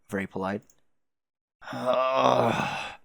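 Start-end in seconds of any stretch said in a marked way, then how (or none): 1.7-2.9 long drawn-out groan; hammed-up weary groan; suffering groan. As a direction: groan in miserable complaint. Acted groan at bright light.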